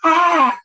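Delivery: high, loud comic scream; short yelp of mock panic. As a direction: scream as if the plane is dropping. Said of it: "I was".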